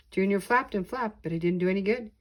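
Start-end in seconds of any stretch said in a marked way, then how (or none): none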